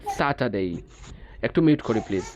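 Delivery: ordinary talk, nothing marked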